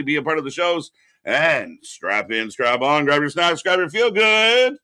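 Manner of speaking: upbeat hype host; announcer. Acted. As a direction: hype the crowd like a show host. Hosts the stream opening.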